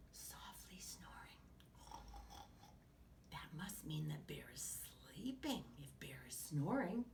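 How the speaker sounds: put-on hushed whispered aside to the kids; storyteller whisper. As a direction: whisper as if not to wake anyone. Whispered aside near sleeping bear.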